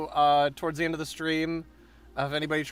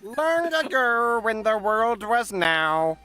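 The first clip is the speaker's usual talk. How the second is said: high, slow, grand narration; epic fantasy narrator; 7 semitones higher, slower than usual. Pretend. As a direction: intone it like an ancient legend.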